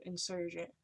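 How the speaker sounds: usual speaking voice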